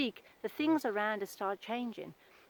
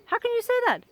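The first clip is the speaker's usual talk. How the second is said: much higher, louder indignant cry; shocked; 13 semitones higher, 7 dB louder than usual. Retold or imitated. Friend reacting to negative self-talk, shocked and indignant. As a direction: gasp it out in disbelief.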